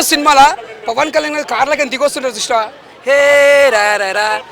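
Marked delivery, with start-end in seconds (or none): 3.1-4.4 slow, stretched-out hero call; hero's style. Retold or imitated. Fan imitating Pawan Kalyan stepping out of a car. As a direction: drawl it out like the star stepping from the car. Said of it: "ఆ style అంటే ఎట్లుంటారు".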